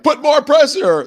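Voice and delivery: high, loud, drawn-out cry; mock demanding shout. Host mimicking the president and his crowd, mock demanding shout. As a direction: push it out slowly as an exaggerated yell.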